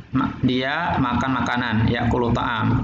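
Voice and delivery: ordinary talk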